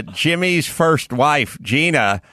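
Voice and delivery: normal talking voice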